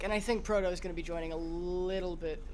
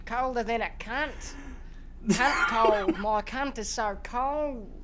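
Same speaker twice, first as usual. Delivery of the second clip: freezing Aussie; 3 semitones higher, 6 dB louder than usual. Pretend. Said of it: "I like the ones that are".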